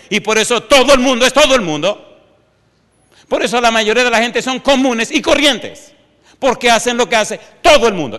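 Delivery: high, loud, driving sermon delivery; fired-up preacher rising in pitch; preaching at full force. Acted. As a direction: hammer the point home to the congregation.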